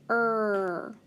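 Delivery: "er" held long as one sound; letter sound. Shown as a demonstration. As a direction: draw out "er" for the speller. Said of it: "E R says er".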